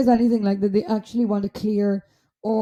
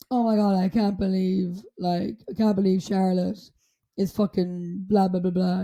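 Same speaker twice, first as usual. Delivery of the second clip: low-key negative grumble, demonstrated; 3 semitones lower, 3 dB quieter than usual. Shown as a demonstration. Showing how negative words sound, downbeat tone. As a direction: say it downbeat, like a complaint. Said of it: "something negative, let's say".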